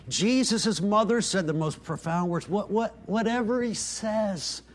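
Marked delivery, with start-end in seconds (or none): none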